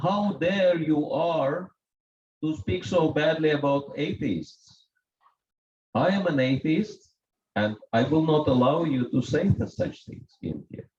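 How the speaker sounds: mild retold shout. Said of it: "started shouting at me".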